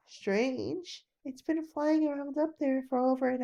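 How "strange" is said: usual speaking voice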